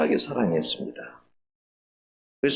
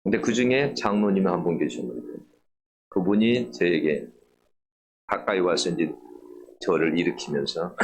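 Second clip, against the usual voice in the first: normal talking voice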